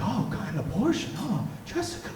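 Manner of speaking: soft, high-pitched scandalized gasp of words; hushed shock. Acted. Gossiping friend hears of Jessica's abortion, hushed dismay. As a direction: react in a hush, as if scandalized. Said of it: "it would sort of be in a hush".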